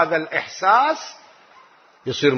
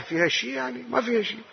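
softly asked and answered, pitched up; soft rhetorical aside; 7 semitones higher, 8 dB quieter, faster than usual. Acted. Preacher asking the crowd softly, then answering himself. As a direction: drop to a hush for the answer.

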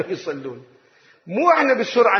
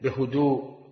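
ordinary talk, nothing marked

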